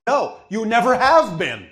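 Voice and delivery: loud, high, hammering home; emphatic insistence. Real, not acted. Insists you were never that person.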